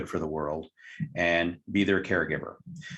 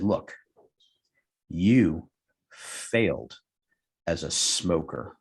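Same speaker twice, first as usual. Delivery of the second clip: you, failed, as a smoker, each word set apart by pauses; slow pointed verdict; 3 semitones lower, slower than usual. Retold or imitated. Hypnotist tells the smoker he failed, slow and deliberate.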